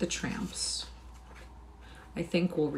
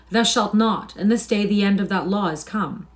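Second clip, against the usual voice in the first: loud, raised proclamation; ringing voice; 3 semitones higher, 8 dB louder than usual. A narrator's reading. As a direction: ring it out to the room. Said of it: "A ringing voice came through".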